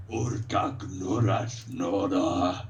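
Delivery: slow ogre growling in its own tongue; slow, heavy-paced creature voice; ogre speech. Acted. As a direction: rumble it out slowly like an ogre. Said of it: "Ogre just says, ... leans over to him and he goes".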